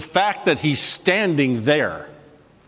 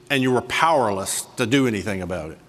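normal talking voice

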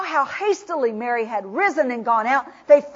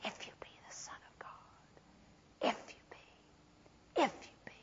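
taunting echo whisper; 24 dB quieter than usual. Acted. Tempter's echo in Jesus' mind, whispered. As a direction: whisper it like a haunting memory. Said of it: "that echo of that Temple Mount".

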